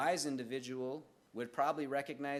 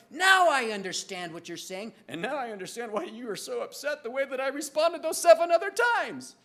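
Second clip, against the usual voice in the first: higher, louder rush of understanding; excited realization; 10 semitones higher, 9 dB louder than usual. Retold or imitated. Preacher voicing himself finally understanding his wife, high and loud. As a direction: pour it out, relieved and fast. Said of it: "it's kinda like".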